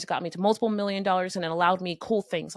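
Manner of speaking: normal talking voice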